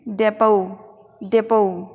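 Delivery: डेपो with the t silent, ending in an o glide. Shown as a demonstration. Teacher models डेपो for students, t silent. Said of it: "तो इसे बोलना है हमें".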